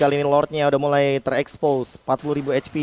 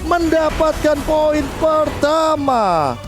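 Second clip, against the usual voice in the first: fighting game announcer; 7 semitones higher than usual. Real, not acted. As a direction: build the hype to the kill. Caster climax to first point.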